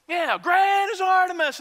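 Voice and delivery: very high, loud mob chant; joining the yelling. Acted. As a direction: jump in and yell along with the crowd. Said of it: "they start yelling and screaming".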